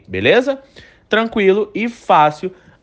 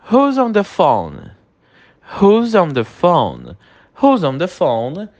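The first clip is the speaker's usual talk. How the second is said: slow, clear English model phrase; teacher modelling slowly for repetition; slow model sentence; slower than usual. Shown as a demonstration. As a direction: say it slowly so students can repeat. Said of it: "Repete a pronúncia comigo".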